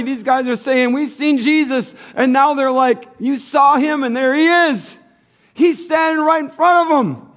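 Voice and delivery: loud, high, driving preaching; full-force preaching. Acted. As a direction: preach it to the back row.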